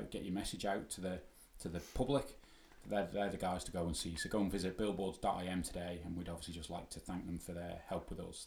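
ordinary delivery

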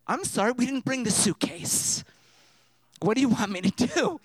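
exasperated retort. Retold or imitated. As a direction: throw it back at the nurse, helpless. Exasperated retort to the nurse, retold.

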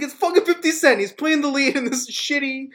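fast, high, indignant ranting; retold furious rant. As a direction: rattle off the outrage at a clip, pitched high. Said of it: "I was furious".

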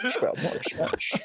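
hushed, slushy Scottish impression; every s slurred into sh; mock film-star lisp, kept soft; Sean Connery. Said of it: "could you imagine Sean Connery saying".

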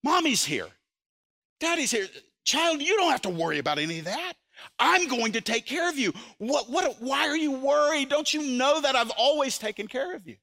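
calm a frightened grandchild; comforting parent. Pretend. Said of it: "our first response would be this".

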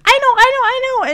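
put-on high, hurried kid's outburst; eager child. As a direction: imitate a kid bursting to answer. Child eager to give answer.

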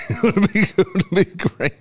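laughing. Real, not acted. Laughing repeat.

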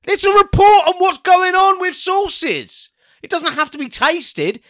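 indignant rant. Real, not acted. Heated defence, high pitch.

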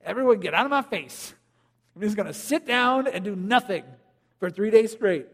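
lazy weary dad wanting to be left alone; mock couch-potato father; passive dad. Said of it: "we're gonna be tempted to just be like".

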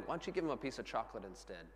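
soft, quick, low-key nudge; gentle suggestion. Retold or imitated. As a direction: voice a quiet prompting from God. God's gentle suggestion, voiced.

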